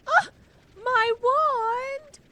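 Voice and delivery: high, loud dismayed cry; Alex Russo wail. Retold or imitated. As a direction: wail like a kid who lost her wand. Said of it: "where Alex Russo goes".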